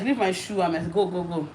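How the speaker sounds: ordinary delivery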